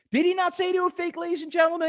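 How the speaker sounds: high rhetorical challenge to the crowd; mock incredulous. Appeals to audience mid-argument.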